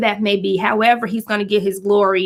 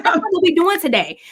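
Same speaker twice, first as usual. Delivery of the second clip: firm mom; 7 semitones higher than usual. Retold or imitated. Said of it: "I'm like".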